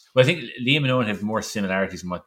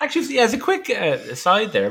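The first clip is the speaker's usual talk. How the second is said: high-pitched, still laughing; laughing aside; 13 semitones higher, 4 dB louder than usual. Real, not acted. Host adding a quick note after a shared joke, laughing. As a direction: start an aside through laughter.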